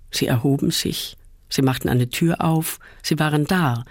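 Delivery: ordinary delivery, nothing marked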